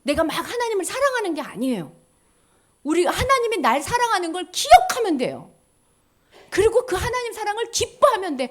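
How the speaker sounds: normal talking voice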